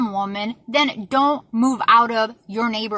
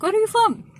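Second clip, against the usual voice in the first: much higher mocking voice of the shopkeeper; 11 semitones higher, 4 dB louder than usual. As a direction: mimic her question with a sneer. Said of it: "she's all like".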